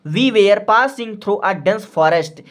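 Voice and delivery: whole sentence said very slowly; slow dictation. Shown as a demonstration. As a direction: read it out slowly for writing. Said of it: "तो simply आप लोग लिख दोगे". (पूरा वाक्य धीरे-धीरे लिखवाना)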